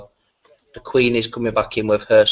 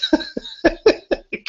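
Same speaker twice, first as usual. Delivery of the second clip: high squealing laugh. Real, not acted.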